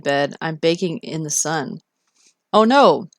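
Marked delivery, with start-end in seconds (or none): 2.5-3.1 surprised. Real, not acted. Reacting to a chat message.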